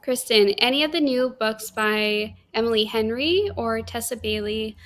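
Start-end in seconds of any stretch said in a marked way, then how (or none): none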